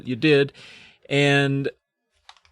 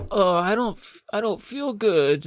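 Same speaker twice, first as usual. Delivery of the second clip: higher, mock sickly whine; feigned sickness; 7 semitones higher than usual. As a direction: play up being ill for sympathy. Kid feigning sickness to parents.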